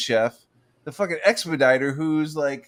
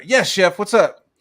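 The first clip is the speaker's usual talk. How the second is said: eager cook reply; 4 semitones higher, 6 dB louder than usual. Retold or imitated. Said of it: "And I'd go".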